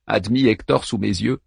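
usual speaking voice

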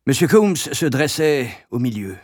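ordinary delivery, nothing marked

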